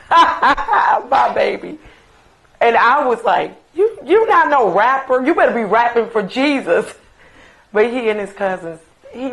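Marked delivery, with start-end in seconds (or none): 0.1-1.8 proud laughing cry. Real, not acted. 3.8-6.9 scolding. Retold or imitated. Mother scolding son.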